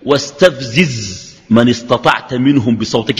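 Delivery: low, drawn-out scripture quote; slow recitation. Retold or imitated. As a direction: recite slowly, stretching the words.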